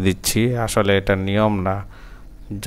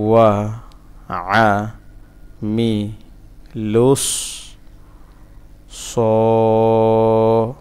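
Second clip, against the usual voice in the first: syllable by syllable. Shown as a demonstration. Teacher breaking a word down for learners, syllable by syllable.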